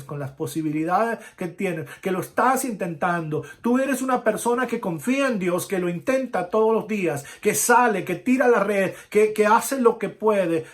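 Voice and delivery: loud, high preaching at full force; fiery preaching. Acted. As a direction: preach it out to the whole church. (predicación enérgica y fuerte)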